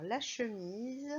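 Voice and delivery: ordinary talk, nothing marked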